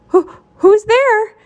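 high, slow, stammered challenge; quavering high question; frightened. A narrator's reading. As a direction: call into the dark, scared and loud. Frightened character challenges the dark.

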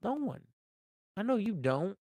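ordinary delivery

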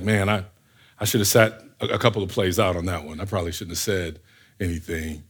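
low, soft muttered second thoughts; sheepish regret. Acted. Joseph thinking he should have kept quiet, sheepish mutter.

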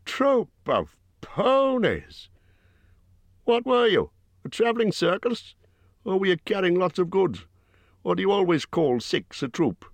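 Bjorn scoffing in disbelief; incredulous Bjorn. A narrator's reading. Bjorn voiced by narrator.

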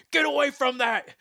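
high-pitched shouted order, imitated; retold yell. Head of the school board ordering the sound guy away, imitated yell. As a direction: yell it like an angry boss. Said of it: "yells at me, and goes".

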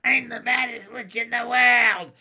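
high, loud gleeful scream-shout; screaming child witch. A narrator's reading. Girl in witch costume runs onto the playground, screaming. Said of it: "Ramona ran screaming onto the playground".

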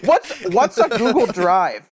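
confused grandma voice. Retold or imitated. Imitating grandma-like guest.

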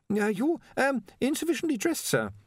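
high, hesitant voice; embarrassed underling. A narrator's reading. Ponder tells his master he is undressed, embarrassed. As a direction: break it delicately, stalling. Nervous Ponder breaks awkward news.

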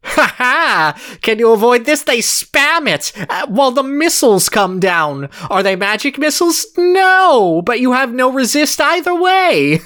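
high, gloating cartoon villain; Skeletor voice. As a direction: cackle and taunt like a Saturday morning villain. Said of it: "I like this Skeletor voice of, of Joker".

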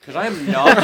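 bursting into loud high laughter; cracking up mid-sentence; laughing. Real, not acted. Laughing mid-joke about triangles.

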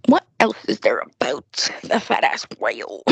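low gossiping whisper. Real, not acted. Sudden whisper about a classmate.

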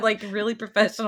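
ordinary delivery

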